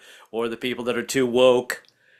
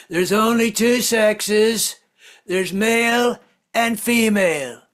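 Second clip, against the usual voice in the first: higher, slow, emphatic; mock Trump pronouncement; Trump impression; 7 semitones higher, 3 dB louder, much slower than usual. Trump lecturing the crowd, slow and emphatic, higher. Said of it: "he constantly says this".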